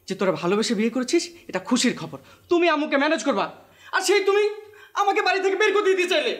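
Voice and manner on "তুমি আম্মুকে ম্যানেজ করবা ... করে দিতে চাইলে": higher, aggrieved protest; indignant accusation. Acted. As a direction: throw the betrayal back at him. Younger brother accuses elder in quarrel.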